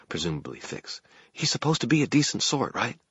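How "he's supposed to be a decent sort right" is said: put-on high-pitched, worried young tenor; anxious young man. A narrator's reading. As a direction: plead for reassurance in a high voice. Anxious Fix seeks reassurance.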